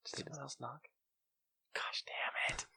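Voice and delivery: very hushed, soft whisper; alarmed whisper. Real, not acted. Host hearing someone else knock mid-recording, whispering.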